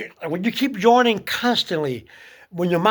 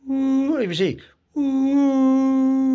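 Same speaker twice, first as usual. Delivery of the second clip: imitating a pitch; 7 semitones higher, much slower than usual. Shown as a demonstration. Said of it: "you'll hear around you like a s-, a pitch".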